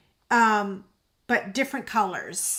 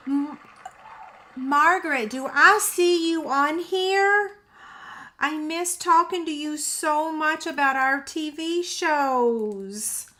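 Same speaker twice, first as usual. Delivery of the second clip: delighted greeting; 5 semitones higher, 4 dB louder than usual. Real, not acted. Host spots an old friend in the live chat, eager and delighted.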